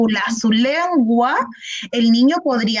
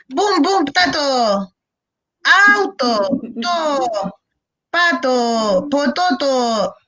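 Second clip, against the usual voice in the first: put-on child fumbling; much slower than usual. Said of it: "No porque lo diga una vez bien".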